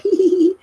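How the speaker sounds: high mock girly giggle; giggling. Imitating the twins' giggle, high and loud. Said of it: "And they was like".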